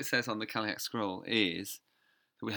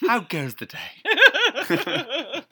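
hammed-up hero voice; 13 semitones higher, 11 dB louder, much slower than usual. Quoting the Doctor.

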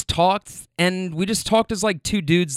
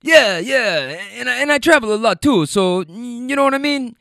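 put-on higher and louder, in a South American accent; 7 semitones higher, 4 dB louder than usual. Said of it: "so I'm trying to do the accent".

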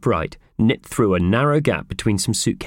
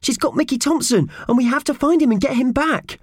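very high, pressing little voice; urgent Purvis. Acted. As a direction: plead for a rescue in a high voice. Purvis urgently pleads with Alan.

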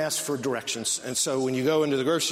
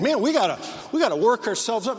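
higher and louder mock pump-up; mock hype; 6 semitones higher, 4 dB louder than usual. Preacher mocking believers who try to work themselves up, hyped. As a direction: imitate someone psyching themselves up. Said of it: "You think".

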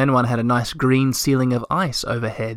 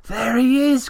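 high, joyful shout; bursting with joy at the sunrise; excited cry; 12 semitones higher than usual. A narrator's reading. Moomintroll sees the sun return, crying out in joy. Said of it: "cried Moomintroll".

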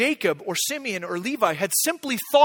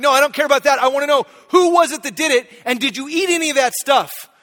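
loud, high, insistent demand; dad grilling his kids, raising his voice; retold angry demand; 6 semitones higher, 9 dB louder than usual. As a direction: press the kids hard for an answer. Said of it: "I'm shouting at them".

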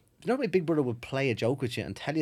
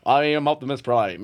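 Optimus Prime robot voice; 3 semitones lower, 5 dB louder than usual. Retold or imitated. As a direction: speak it like a robot through tinfoil. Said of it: "It sounded like a robot".

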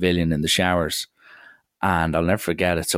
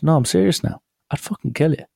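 serious threat; 10 semitones higher than usual. Retold or imitated. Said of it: "He goes".

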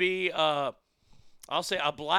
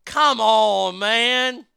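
loud, raised exasperated protest; exasperated outburst; 3 semitones higher, 9 dB louder, much slower than usual. Real, not acted. Host reacting to kindergarten sex ed news, exasperated outburst. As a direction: burst out in loud disbelief.

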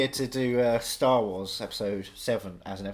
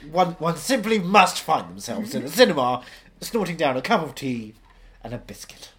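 fake posh slipping common; 4 semitones higher, 7 dB louder than usual. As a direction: play a pompous English snob. Mock English posh speech.

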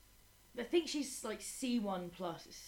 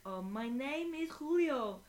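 put-on slow, much higher child's voice; imitating a little boy; 8 semitones higher, 4 dB louder, slower than usual. Little boy answering his teacher, slow and high. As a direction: play the shy little pupil, slowly.

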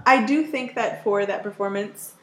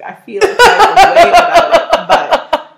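high, loud words through laughter; real laughing; 9 semitones higher, 11 dB louder than usual. Real, not acted. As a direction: crack up while objecting. Cracking up while declining the toast.

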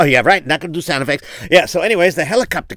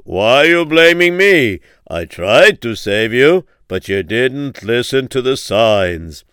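slow, louder divine voice; voice of Hashem; 3 semitones lower, 4 dB louder than usual. Acted. Hashem answers the drowned man, slow and louder. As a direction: speak slowly, weighing every word. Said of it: "just to give you an idea".

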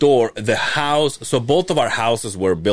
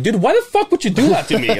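high, exasperated complaint; replaying his annoyed protest, pitch way up; telling off the uncle, high and indignant; mock outrage; 10 semitones higher than usual. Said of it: "I'm like".

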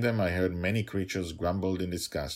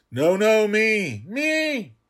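much higher, loud squeal; clamoring prisoner; 12 dB louder, much slower than usual. Acted. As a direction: beg to be chosen.

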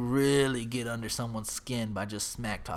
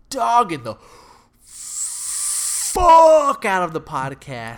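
much louder and higher, dragged out slowly; indignant yell; 6 semitones higher, 11 dB louder, slower than usual. Real, not acted. Telling his co-host how the troll trashed the podcast, loud outrage. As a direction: belt it out in outrage.

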